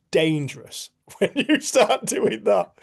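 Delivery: high, slow, laughing delivery; laughing quote. Retold or imitated. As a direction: break into laughter while quoting. Laughs while quoting Jen.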